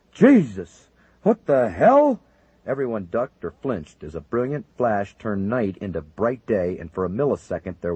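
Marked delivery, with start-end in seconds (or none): 0.1-2.2 startled high shout; shocked outcry. Acted. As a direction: cry out in shock. Sudden blinding flash.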